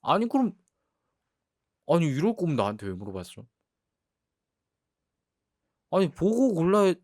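high, loud exasperated protest; indignant protest. Real, not acted. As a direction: push back, outraged. Girlfriend protesting at boyfriend. (억울한 항의)

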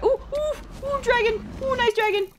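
high, cooing wariness; high-pitched placating baby talk; coaxing the dragon. Pretend. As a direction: coo nervously at a dangerous beast. Coaxes a dragon not to attack.

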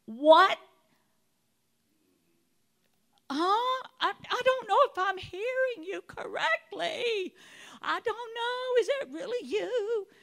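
high, loud, crying disbelief; incredulous disbelief. Pretend. Believer doubting the Lord's call, high and tearful. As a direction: squeak it out like you can't trust your ears. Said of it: "we are often like".

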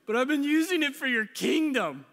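high, loud pleading with God; whiny-comic plea at full voice; mock protest. Acted protest to the Lord.

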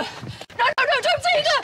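frantic, pleading yell; desperate shout. Acted. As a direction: scream it as she's taken away. Father as his child is taken away.

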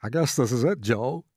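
lightly voiced tough American; a hint of a stoic man's farewell; resigned strong man. A narrator's reading. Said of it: "Strong men turned to one another".

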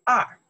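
二 said high and loud; pronunciation of 二. Shown as a demonstration. Teacher showing how number two is pronounced, loud high 二. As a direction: model 二 firmly as a single word. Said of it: "You can pronounce the".

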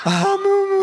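very high, loud moo-laugh. A narrator's reading. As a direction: let the laugh burst out like a moo. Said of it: "fragt Frau Müller".

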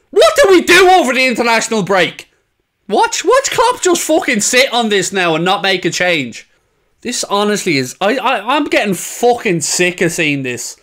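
normal talking voice

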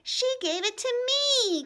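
high, loud, slow, tearful correction; fighting back tears. Acted. Ramona corrects Howie while fighting back tears. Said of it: "corrected Ramona as she fought back tears".

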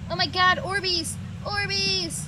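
high, gleeful squeal of discovery; excited exclaiming. Real, not acted. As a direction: cry out in delight at the find. Spots Orbeez in the game.

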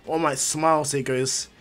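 plain reading voice

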